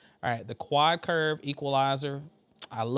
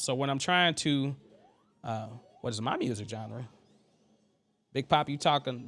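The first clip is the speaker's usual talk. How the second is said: ordinary talk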